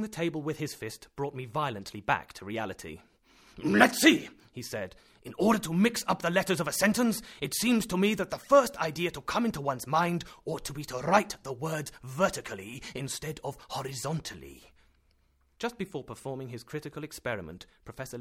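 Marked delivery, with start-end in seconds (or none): 3.6-4.3 loud. Acted. Professor rouses from thought. 5.2-14.7 excited. Acted. Professor explains his method.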